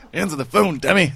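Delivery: high, loud put-on shout; mock yelled title. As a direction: holler it like a comic rebuke. Mimics the record's title.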